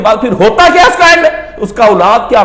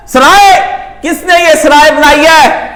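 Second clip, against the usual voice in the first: high, raised-voice demand; loud challenge; 6 semitones higher, 4 dB louder than usual. Retold or imitated. Fakir challenging who built this inn, loud high call. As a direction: throw the question out like a street cry. Fakir's cry at the palace retold.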